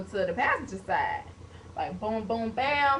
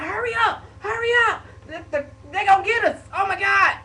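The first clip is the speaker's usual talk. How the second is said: high, loud frantic hurry-up; panicked brother; 8 semitones higher, 5 dB louder than usual. Retold or imitated. Brother urging his sister to flee the wrong car, high frantic panic. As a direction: rush her like someone's coming to get you. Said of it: "he freaking out".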